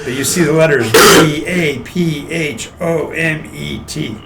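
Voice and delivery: ordinary talk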